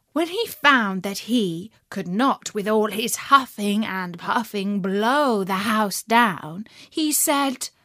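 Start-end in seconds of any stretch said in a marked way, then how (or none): none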